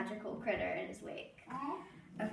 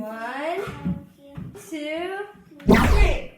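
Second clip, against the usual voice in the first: rising louder count to a shout; building countdown; 5 semitones higher, 11 dB louder, much slower than usual. Pretend. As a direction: build the count up to a big reveal. Countdown to reveal.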